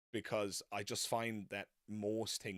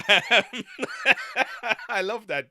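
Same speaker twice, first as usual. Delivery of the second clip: talking through laughter, high and loud; real laughing; 14 semitones higher, 18 dB louder, slower than usual. Real, not acted. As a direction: let the laugh spill into the words. Amused by his American pronunciation.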